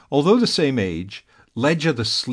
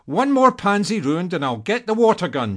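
warning; 8 semitones higher than usual. A narrator's reading. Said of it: "she warned".

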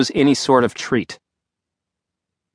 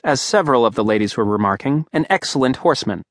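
ordinary delivery

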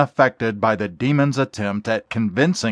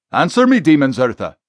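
quick, louder, higher demand; pushing command; 5 semitones higher, 5 dB louder, faster than usual. Acted. Exorcist pressing the demon, quick loud command. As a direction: press the demon hard for an answer. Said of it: "Tom quickly pushes".

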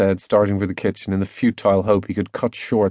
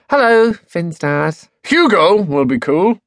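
high, slow, careful hello; awkward greeting; 8 semitones higher, 4 dB louder, slower than usual. A narrator's reading. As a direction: draw out an unsure greeting, louder. Girl settles on an awkward greeting.

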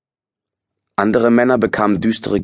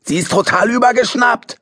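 yelling into the wind. A narrator's reading. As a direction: rage at the world, full voice. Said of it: "brüllte er in den Wind".

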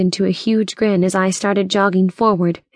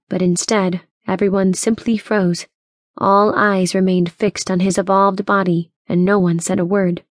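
plain reading voice

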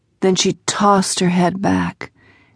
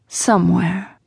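smoky voice. A narrator's reading. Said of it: "Her voice was as smoky as her cigarette".

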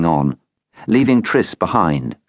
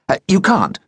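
said quickly; 13 semitones higher than usual. A narrator's reading. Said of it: "Triss said quickly".

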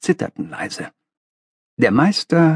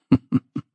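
brief knowing chuckle; short chuckle. Acted. Master amused by the young man's question, short chuckle. Master answers with a little laugh.

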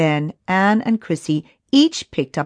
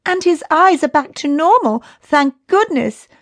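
relieved; 10 semitones higher, 4 dB louder than usual. A narrator's reading. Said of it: "agreed Chrissy".

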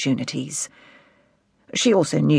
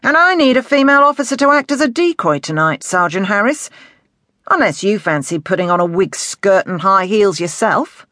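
dry jibe delivered as if serious, pitched up; mock-serious; 4 semitones higher, 5 dB louder than usual. A narrator's reading. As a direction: pull rank on the sergeant, seemingly in earnest. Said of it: "in a tone that sounded as if he was being serious".

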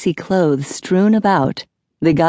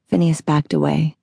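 usual speaking voice

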